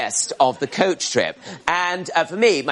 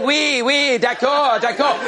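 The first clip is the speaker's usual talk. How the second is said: bluffing French; 8 semitones higher, slower than usual. Retold or imitated. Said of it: "responded by saying".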